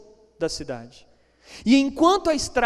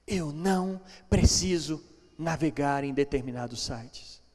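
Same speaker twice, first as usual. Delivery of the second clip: much softer and lower, slow and intimate; hushed preaching; 5 semitones lower, 8 dB quieter than usual. Real, not acted. Preacher quietly asks the church why we sin, hushed and slow. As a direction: draw them in close, almost whispering. Quiet reflective sermon close.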